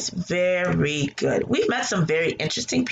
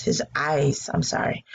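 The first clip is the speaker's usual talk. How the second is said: usual speaking voice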